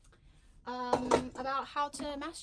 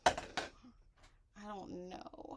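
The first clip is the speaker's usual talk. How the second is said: whisper; 2 semitones lower, 5 dB quieter, much slower than usual. Pretend. After reading viewer question.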